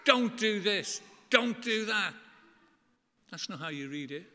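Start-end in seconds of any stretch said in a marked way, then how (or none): 0.0-2.1 mock angry shout, higher and louder; imitating an angry God forbidding things. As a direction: scold like a thundering angry God. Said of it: "this angry God saying".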